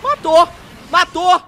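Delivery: high, loud triumphant yell; excited shout. Real, not acted. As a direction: cheer the kill. Reagindo à morte. (grito de empolgação)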